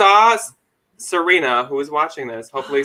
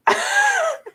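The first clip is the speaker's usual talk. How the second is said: squealing laugh, much higher; high burst of laughter. Real, not acted. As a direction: break into a shrieking giggle.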